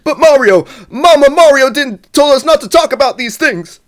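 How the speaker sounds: very high, loud cartoon Luigi. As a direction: squeal it out like Luigi. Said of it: "your impression's getting better and better".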